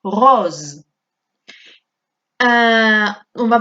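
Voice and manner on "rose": rose with a buzzing voiced Z sound. Shown as a demonstration. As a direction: lean on the Z in rose. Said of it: "Mirad cómo pronunció la S". (rose con S sonora como Z)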